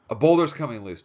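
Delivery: thrilled at coming danger, burst out; excited. Acted. Sound guy hoping for disaster on film, excited call. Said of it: "Jack said excitedly".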